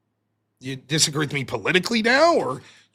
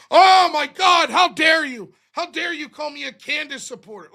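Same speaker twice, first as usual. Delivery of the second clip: mock outrage; 10 semitones higher than usual. Mocking a critic who is offended, hammed-up outraged shout. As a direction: ham up the offended accuser, shouting.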